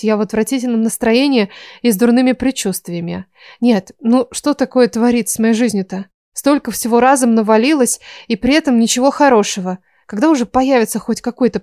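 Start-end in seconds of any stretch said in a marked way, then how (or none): none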